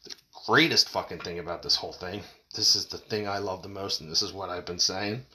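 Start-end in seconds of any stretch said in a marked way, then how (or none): none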